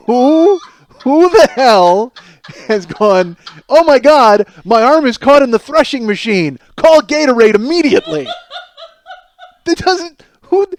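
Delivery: loud, high disbelieving rant; incredulous rant. Acted. Comic ranting at a Gatorade recording, loud and incredulous. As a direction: shout it out in outraged disbelief.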